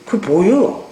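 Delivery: a puzzled Gyeongsang-dialect question; 경상도 사투리로 어리둥절하게. Retold or imitated. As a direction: ask blankly, with a hint of Gyeongsang dialect. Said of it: "옆에 있는 경상도 사람이".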